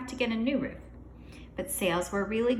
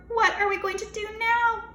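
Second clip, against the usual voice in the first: panicked; 12 semitones higher, 4 dB louder than usual. A narrator's reading. Panicked question.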